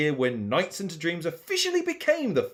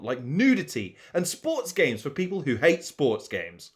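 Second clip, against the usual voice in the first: normal talking voice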